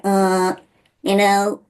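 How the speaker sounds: demonstrated nasal twang; American twang. Shown as a demonstration. Voice teacher showing American twang, nasal demo. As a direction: push a twangy sound through the nose. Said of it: "some really strong twangs in the American language".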